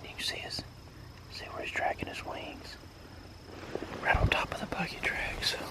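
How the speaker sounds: hushed whisper in the woods; hunting whisper. Real, not acted. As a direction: whisper so the birds can't hear.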